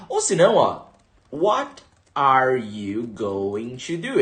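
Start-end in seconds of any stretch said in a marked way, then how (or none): 1.3-4.2 "What are you going to do" bem lento e separado; inglês de escola. Shown as a demonstration. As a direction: articule cada palavra devagar. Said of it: "É assim que a escola tradicional te ensina". (o inglês dos livros)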